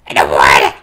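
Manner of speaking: low, loud put-on sulky voice; childish whine. Gamer mocking a game character's order in a low, loud, sulky voice. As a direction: stamp your foot like a sulking child.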